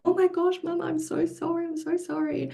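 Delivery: put-on high, soft, tearful child voice; imitating crying child. Daughter apologises to mum after knocking her over, tearful and high. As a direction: retell a daughter's sorry plea, high and hushed. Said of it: "she was crying".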